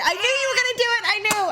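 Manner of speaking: high, fast laughing; laughing sorry to her sister; laughing apology. Real, not acted. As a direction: gush it out laughing.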